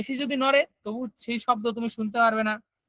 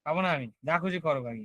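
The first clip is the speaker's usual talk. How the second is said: angry low voice; 7 semitones lower, 5 dB quieter than usual. Shown as a demonstration. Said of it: "রাগ উঠলে তুমি কেমন করে কথা বলো?".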